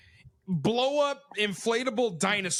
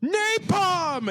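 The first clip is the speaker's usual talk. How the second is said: kid playing war; 10 semitones higher, much slower than usual. Kid calls an air strike on army men, excited high call. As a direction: shout it like a kid in a game. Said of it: "and I'd be like".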